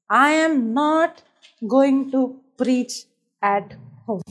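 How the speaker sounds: slow, spaced-out words; deliberate resolve. Retold or imitated. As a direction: lay it down word by word. States her resolve word by word.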